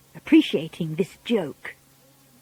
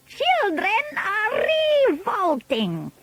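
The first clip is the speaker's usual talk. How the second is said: high, slow, disgusted witch voice; Grand High Witch; 12 semitones higher, 3 dB quieter, slower than usual. A narrator's reading. Witch leader denouncing children to her audience, high slow villain voice.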